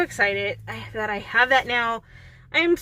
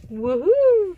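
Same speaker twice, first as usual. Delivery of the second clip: excited cheer; 12 semitones higher, 7 dB louder than usual. Real, not acted. Celebrating after getting the shot.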